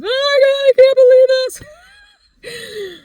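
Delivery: very high, slow, tearful sobbing words; crying in disbelief. Retold or imitated. Reliving winning the proposal, crying high and slow. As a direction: weep it out, overwhelmed by the good news.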